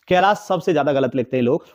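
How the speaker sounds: ordinary talk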